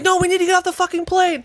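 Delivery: high, fast, frantic insistence; urgent pleading. Retold or imitated. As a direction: beg them to get off, fast. Hero pleading with passengers.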